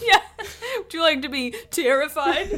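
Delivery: laughing. Pretend. Mocking brain's voice, laughing.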